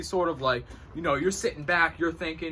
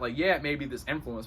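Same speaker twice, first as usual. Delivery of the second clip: ordinary talk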